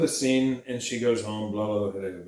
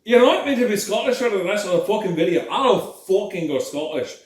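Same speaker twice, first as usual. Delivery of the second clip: put-on hearty, joking Scottish accent; 3 semitones higher, 3 dB louder than usual. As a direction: play up a thick Scottish accent. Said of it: "that one I can get away with 'cause I am Scottish".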